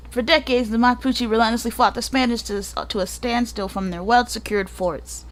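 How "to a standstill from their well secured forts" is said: ordinary delivery, nothing marked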